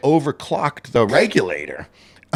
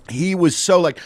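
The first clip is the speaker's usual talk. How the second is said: ordinary delivery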